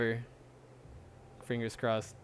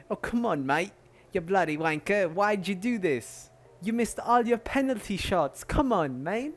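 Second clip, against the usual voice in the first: higher, hammed-up English fan berating players in a British accent; mock British fan; 6 semitones higher than usual. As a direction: scold like an angry English football fan.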